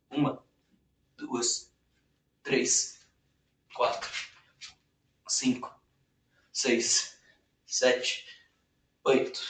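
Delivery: strained counting mid push up; doing push ups. Real, not acted. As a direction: count reps through the effort. (contando flexões com esforço)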